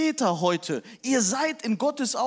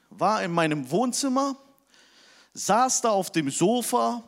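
normal talking voice

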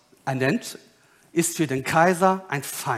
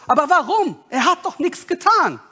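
high-pitched, incredulous objection; protesting Pilate; 8 semitones higher, 3 dB louder than usual. Acted. Pilate defending Jesus to the crowd, high and protesting. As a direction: plead his innocence, voice jumping up.

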